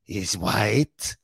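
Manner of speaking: odd old-world accent, slow and hammed-up; Tom Hanks as Parker. Mocking Tom Hanks' movie accent, hammed-up old-world voice. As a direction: lay on a strange European accent like an old cleric. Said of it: "He sounds like that Pope Benedict".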